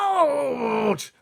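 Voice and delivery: very high, very loud pained roar. Acted. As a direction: bellow the sudden pain. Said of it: "roared the blood butler".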